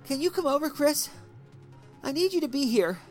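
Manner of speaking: holding back tears; tearful. A narrator's reading. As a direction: shaken wife asking friend to come. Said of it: "the tears came streaming".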